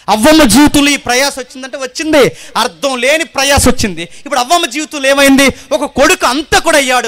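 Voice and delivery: loud, high sermon cry to the congregation; loud pleading preacher; fiery preaching. Acted. Preaching at full force.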